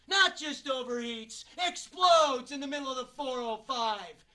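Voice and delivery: loud, emphatic disbelief; indignant outburst. Acted. Actor recounting car exploding on the freeway, indignant and loud. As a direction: hammer home how bad it was.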